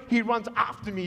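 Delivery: normal talking voice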